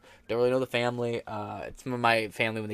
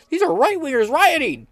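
much higher, louder mocking voice; mocking media quote; 7 dB louder than usual. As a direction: parody the news claim, pitched way up. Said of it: "they were saying".

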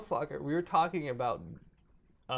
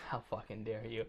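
usual speaking voice

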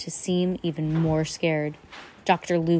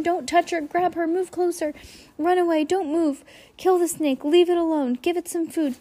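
very high, fast panicked babble; high-pitched babbling; 12 semitones higher, 4 dB louder than usual. A narrator's reading. Banker babbling at the snake, high-pitched panic. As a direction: babble everything at once in a shrill panic. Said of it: "in such a high-pitched voice".

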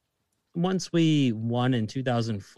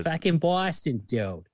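punchy Boston accent, higher start dropping on "dude"; emphatic Boston-accent demo; 4 semitones higher, slower than usual. Shown as a demonstration. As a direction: say it like a Boston local, BAW-stin. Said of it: "the Boston accent".

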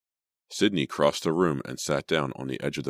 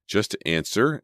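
higher, insistent command; demanding; 6 semitones higher, 3 dB louder, slower than usual. A narrator's reading. Emma pressing Sydney about the money, demanding. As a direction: press for an answer. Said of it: "Emma demanded".

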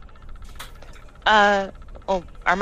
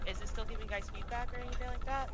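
very soft distant mic; quiet mic; 5 semitones higher, 12 dB quieter, faster than usual. Real, not acted. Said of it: "Now you're really soft".

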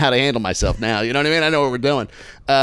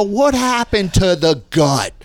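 slow, higher-pitched mock demand; drawn-out taunt; 4 semitones higher, 3 dB louder, much slower than usual. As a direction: stretch each word of the taunt. Retold belly-slapping taunt, repeated.

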